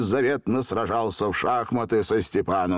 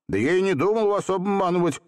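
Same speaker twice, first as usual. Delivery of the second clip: put-on high, timid boy's protest; trembling voice; 9 semitones higher than usual. A narrator's reading. Seryozha denying a trick to the genie, trembling. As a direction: protest nervously in a raised pitch. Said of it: "ответил дрожащим голосом Серёжа".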